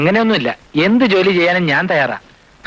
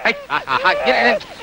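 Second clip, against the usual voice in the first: rocking singsong coo of vaa vo; soothing baby talk; 6 semitones higher, slower than usual. Acted. Father rocking his crying baby, cooing a soothing singsong. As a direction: hush the crying baby with a lilting chant.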